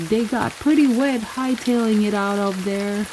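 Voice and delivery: usual speaking voice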